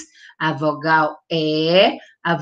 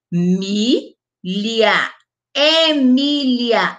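slow teaching repeat; 3 semitones higher, much slower than usual. Shown as a demonstration. Teacher modelling the name for children, slow and clear. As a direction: model the name slowly twice. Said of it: "ó, é".

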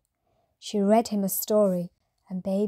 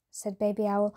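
plain reading voice

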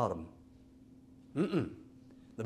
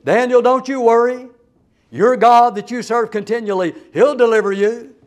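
a louder, higher call of comfort down into the pit; king's loud reassurance; 6 semitones higher, 10 dB louder than usual. Acted. Old heathen king calling down to Daniel in the den, loud and reassuring. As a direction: shout your faith down to him, loud and sure.

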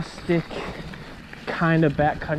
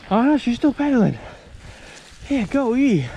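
excited cheer; 10 semitones higher than usual. Real, not acted. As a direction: cheer her on from above. Cheering on his wife.

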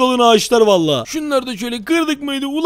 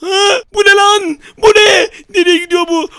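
high, loud frightened yelling; screaming in shock; terrified shout; 7 semitones higher, 5 dB louder than usual. Acted. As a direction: yell in fright at the thing rushing past. Sees monster, yells.

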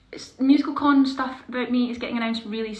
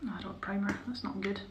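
unvoiced muttered whisper; hushed aside while doing makeup; 9 dB quieter than usual. Real, not acted. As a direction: whisper it to yourself.

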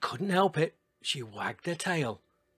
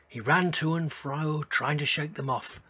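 usual speaking voice

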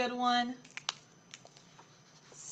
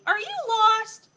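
put-on high, louder baby-talk question; cooing lady; 10 semitones higher, 11 dB louder, slower than usual. Lady talking to a cute little kitty, high cooing. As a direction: coo it high, as to a kitten.

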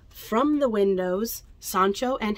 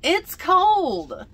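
put-on whiny child; 10 semitones higher, 8 dB louder than usual. Pedro whining.

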